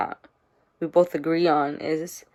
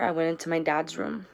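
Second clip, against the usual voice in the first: ordinary talk, nothing marked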